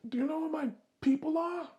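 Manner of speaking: very high Italian-American wiseguy voice; hint at mob ties in an Italian accent; acted Italian accent, incredulous and high. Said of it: "I'm doing my Italian accent".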